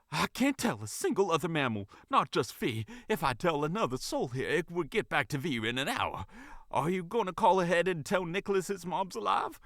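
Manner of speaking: high, eager and dramatic; excited gossip. A narrator's reading. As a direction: savour the juicy drama. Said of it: "seeming to absolutely feast on the drama".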